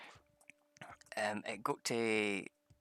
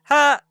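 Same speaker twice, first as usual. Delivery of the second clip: much higher, loud triumphant ha; gloating shout ha; 19 dB louder than usual. Acted. Player gloating at the downed Paladin, triumphant ha. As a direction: crow in triumph.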